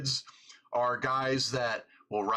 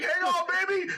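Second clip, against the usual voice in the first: high, louder mock urgent call; 4 dB louder than usual. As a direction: call out to her in a panic. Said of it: "I'm like".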